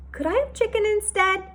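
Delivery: high, hesitant plea; a nervous boy asking to swap; timid boy. A narrator's reading. Timid boy asks waitress.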